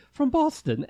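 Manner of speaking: a put-on posh Boston accent. Shown as a demonstration. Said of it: "talk like this".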